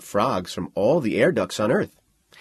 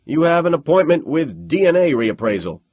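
crisp; 7 semitones higher, 7 dB louder than usual. Acted. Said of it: "said crisply".

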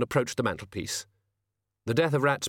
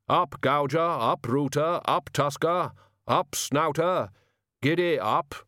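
calling the pigs; 5 semitones higher, slower than usual. Acted. Figure calls to boars.

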